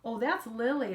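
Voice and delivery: usual speaking voice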